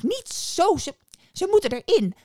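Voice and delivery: loud, high shrieking witch; exasperated yell. A narrator's reading. Witch shrieks instructions at the boy. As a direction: shout it in exasperation.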